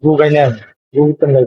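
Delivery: ordinary talk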